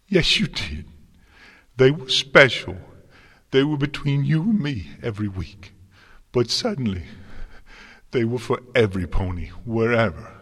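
high, loud, upset outburst; shouting. A narrator's reading. Twilight accusing her mentor over her special letters, shouting. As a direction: raise pitch and volume, wounded and accusing. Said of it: "Twilight shouted".

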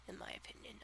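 soft whispered aside. Real, not acted. Vlogger whispering her opinion aside.

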